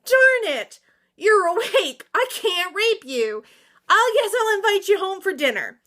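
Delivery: hammed-up whiny prince; mock prince voice. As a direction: play the thwarted villain, petulant. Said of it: "he goes".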